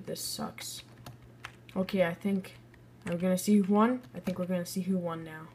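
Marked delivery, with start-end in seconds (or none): 0.1-0.8 unvoiced whisper of disappointment; whispered grumble. Real, not acted. Player regretting his rating, whispered grumble. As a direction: breathe it out, annoyed.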